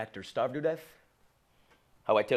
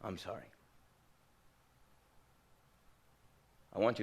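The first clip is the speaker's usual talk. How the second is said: mild tearful father; 7 semitones lower, 7 dB quieter than usual. Acted. Father crying, apologizing on phone.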